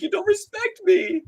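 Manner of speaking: mock needy girlfriend. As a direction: whine it like a hurt girlfriend, hammed up. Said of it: "literally being like a, a fucking girlfriend".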